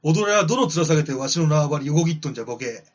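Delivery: loud, rough yakuza-style growl; gruff gangster boss voice. Acted. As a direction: bark it like a tough old mob boss. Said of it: "ティタノボアさんの言葉".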